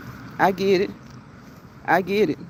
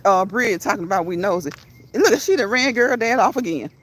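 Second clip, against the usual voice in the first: usual speaking voice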